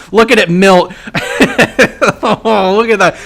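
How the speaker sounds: excited. Real, not acted. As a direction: cheer it on as it dies. Watching the enemy unit die.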